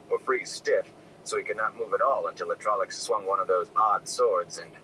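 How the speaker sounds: ordinary delivery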